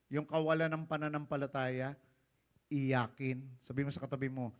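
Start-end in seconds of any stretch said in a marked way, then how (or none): none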